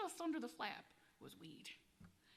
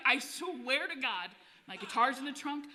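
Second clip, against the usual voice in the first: louder and higher, desperate to be believed; emphatic insisting; 3 semitones higher, 8 dB louder than usual. Retold or imitated. Musician convincing a doubting cop, loud insistence.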